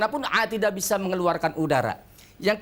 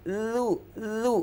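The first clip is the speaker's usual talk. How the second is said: ordinary talk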